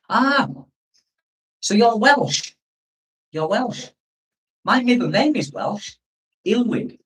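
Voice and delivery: high, swooping mock Welsh lilt; playful Welsh lilt. Streamer greeting a Welsh viewer, playful Welsh lilt. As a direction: put on a light Welsh sing-song.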